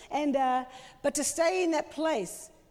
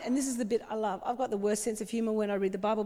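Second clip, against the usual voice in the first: ordinary delivery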